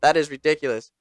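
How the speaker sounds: ordinary talk, nothing marked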